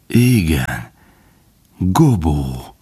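hushed, whispery with emotion; trembling, deeply moved. A narrator's reading. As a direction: breathe the name out in wonder. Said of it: "A hangja reszketett, izgatott volt".

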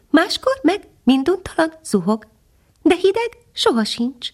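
a little hidden bird answering from the branches; tiny nightingale. Acted. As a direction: speak as a tiny, gentle songbird. Nightingale voiced in story.

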